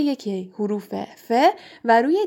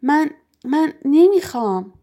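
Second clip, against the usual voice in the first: higher, louder, halting choked protest; 5 semitones higher, 5 dB louder than usual. A narrator's reading. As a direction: refuse in a choked-up voice. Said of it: "پرسی با صدایی گرفته گفت".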